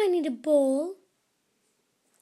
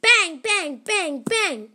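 banging sounds; 5 semitones higher, 8 dB louder, slower than usual. A narrator's reading. Baby banging pot.

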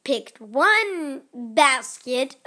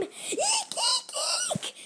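terrified squeals; 5 dB quieter than usual. Acted. Scared squirrel squeaks.

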